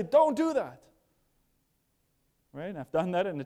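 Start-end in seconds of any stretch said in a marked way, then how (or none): 0.0-0.8 sharp, high, loud command; upset parent. Acted. Parent pulling child's hand from the fire, upset command. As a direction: order the child to stop, loudly. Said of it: "You get upset".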